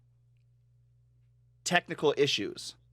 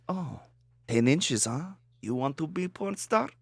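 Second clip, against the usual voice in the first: intrigued Russian caller; hammed-up Russian accent, sizing someone up; thick Russian accent; 5 semitones higher than usual. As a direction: imitate a Russian customer, suddenly interested. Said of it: "in a super thick Russian accent".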